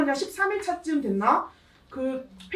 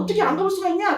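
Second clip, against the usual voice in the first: retold scolding; 7 semitones higher, faster than usual. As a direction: scold them like the lawmaker did. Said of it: "혼냈더니".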